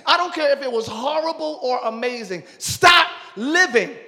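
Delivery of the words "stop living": usual speaking voice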